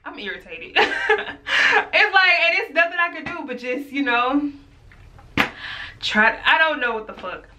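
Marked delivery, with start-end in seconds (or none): 0.8-1.9 high-pitched burst of laughter; exasperated high laugh; high squealing laugh. Real, not acted. Laughs at own irritation.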